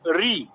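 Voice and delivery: "री" with a long ee vowel; pronounced "री". Shown as a demonstration. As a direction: say "री" as a clear ree. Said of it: "री बोलोगे हमेशा".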